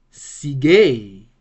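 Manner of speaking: "Sige" with a long, forceful last syllable; accent on last syllable. Shown as a demonstration. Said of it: "these are very simi".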